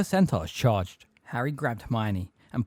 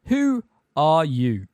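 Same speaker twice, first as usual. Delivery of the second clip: loud, slow, higher-pitched demand; stern challenge; 5 semitones higher, 6 dB louder, much slower than usual. A narrator's reading. Centaur to intruders in the forest, stern challenge. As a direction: draw out the challenge.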